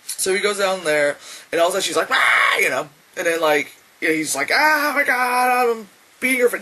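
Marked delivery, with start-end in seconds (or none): 2.1-2.6 sudden loud yelp imitating her; quick acted shriek; short mock scream. 4.5-6.6 acted panic. Acting out panicked brother.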